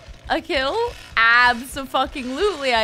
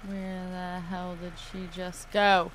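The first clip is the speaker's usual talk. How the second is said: ordinary talk, nothing marked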